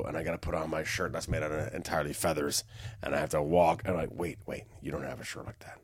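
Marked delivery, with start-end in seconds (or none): 4.1-5.8 softer, unvoiced whisper; hushed whisper of a half-asleep man; whispered realization. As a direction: whisper as you catch yourself. Retold self-correction while dreaming.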